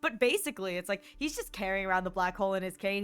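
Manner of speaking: ordinary talk, nothing marked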